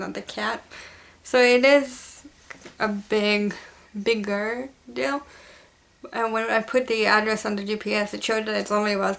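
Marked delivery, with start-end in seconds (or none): none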